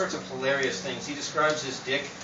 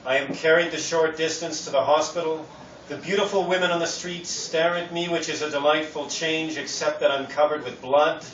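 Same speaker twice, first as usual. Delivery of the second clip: projected, measured verse recital; poetry reading; 3 semitones higher, 4 dB louder than usual. Acted. Poet recites his bike accident poem, projected reading. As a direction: read the poem out clearly to the room.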